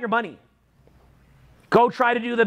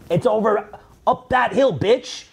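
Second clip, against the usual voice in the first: normal talking voice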